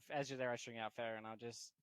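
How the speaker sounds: ordinary delivery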